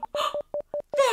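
a sharp, high gasp of surprise; surprised gasp. Acted. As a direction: gasp as if spotting something amazing. Seeing Snarge through cosminoculars.

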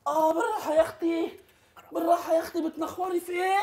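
high pained plea with a laugh. Acted. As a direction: wince and beg her to ease off. (رجاء متوجع عالي)

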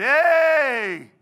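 long, high, very loud hey; drawn-out mock holler; over-familiar shouted greeting. Acted loud greeting on first meeting.

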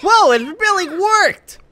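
high, drawn-out cartoon amazement; amazed game character. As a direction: cry it out high and astonished.

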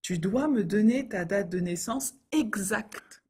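ordinary talk

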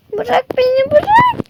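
a tiny bear squealing to be let go; squeaky captive cry. Acted. Captured bear struggles.

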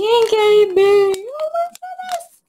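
high, thrilled squeal over the pink; barely held-back scream of joy; excited squeal. Real, not acted. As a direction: squeal with delight at the colour. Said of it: "trying not to scream in your ear".

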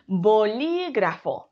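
slow "bolígrafo" with long LI; bo-LÍÍ-gra-fo; stress on second syllable. Shown as a demonstration. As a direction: stretch LI in "bolígrafo". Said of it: "pronunciamos con ese golpe de voz".

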